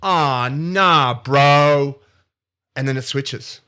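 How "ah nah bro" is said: loud drawn-out bro drawl, louder. Retold or imitated. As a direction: brush it off like a loud surfer bro. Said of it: "he goes".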